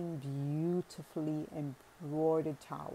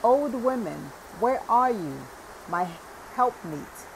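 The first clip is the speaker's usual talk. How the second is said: louder and higher call toward the door; shouting; 5 semitones higher, 6 dB louder than usual. A narrator's reading. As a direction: call out for his wife in another room. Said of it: "And he shouted".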